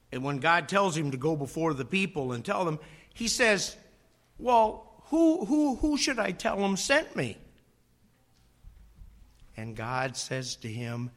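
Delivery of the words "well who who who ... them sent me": higher, hesitant stammer of a reluctant man; stammering Moses. Retold or imitated. Moses unsure before God, stammering. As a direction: stumble over the who as if unsure.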